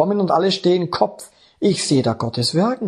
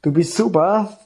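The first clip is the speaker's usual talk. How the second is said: higher, eager offer to God; eager prayer; 6 semitones higher than usual. Retold or imitated. New convert eagerly tells the Lord to send him, raised. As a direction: pledge yourself with raised, eager zeal. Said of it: "habe ich zum Herrn gesagt".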